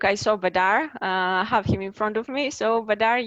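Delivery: usual speaking voice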